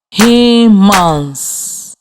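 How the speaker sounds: HU-MANS split into two slow even beats; teacher modeling the syllables of Humans; clapped syllables. Shown as a demonstration. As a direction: say Humans beat by beat, one clap per syllable. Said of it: "While I clap the syllables in the word".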